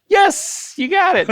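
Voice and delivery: high delighted yes; thrilled congratulation; excited praise. Real, not acted. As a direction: cheer the win. Celebrating a correct answer.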